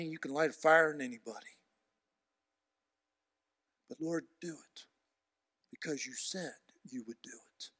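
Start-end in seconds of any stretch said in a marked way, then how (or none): 3.9-7.7 hushed, whispered plea; whispered prayer. Real, not acted. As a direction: whisper it to God.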